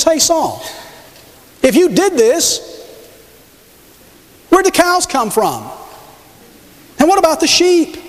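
high, sharp challenge, rising to a near-cry; a prophet calling out a liar, high and pressing; indignant prophet. Acted. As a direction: confront him, pointing at the cattle. Samuel confronts Saul.

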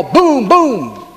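playful high boom boom; mock yell. Shown as a demonstration. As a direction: shout it like a pretend grenade. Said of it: "and yell".

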